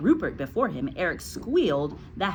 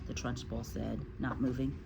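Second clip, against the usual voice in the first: usual speaking voice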